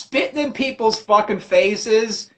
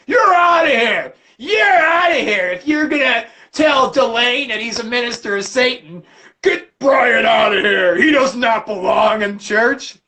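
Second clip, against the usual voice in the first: mock outraged shout. Church member yelling to throw Brian out.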